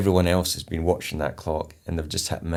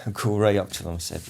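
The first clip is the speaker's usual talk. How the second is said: ordinary talk, nothing marked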